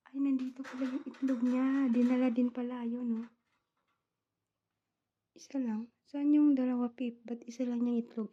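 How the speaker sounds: loud, excited find; surprised discovery. Real, not acted. Owner finds the pet bird's egg, loudly surprised.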